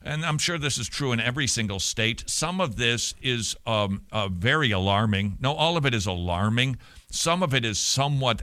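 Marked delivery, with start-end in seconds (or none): none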